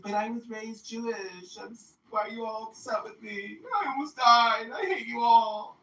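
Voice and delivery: mock-wail her grievance; indignant girl. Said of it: "she's like".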